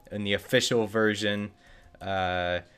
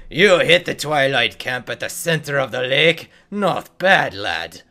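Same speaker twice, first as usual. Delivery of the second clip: louder, higher hearty dwarf, lightly voiced; dwarf NPC voice; 3 semitones higher, 7 dB louder than usual. Quest giver dwarf praising the player, lightly voiced.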